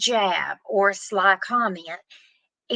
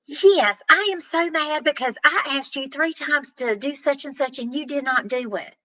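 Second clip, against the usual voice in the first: raised, higher angry reply; angry spouse; 6 semitones higher than usual. Acted. Spouse snaps back at partner about ignored chores, angrily. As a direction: snap back at your partner, fed up.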